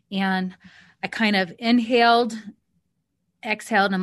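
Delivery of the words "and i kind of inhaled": ordinary delivery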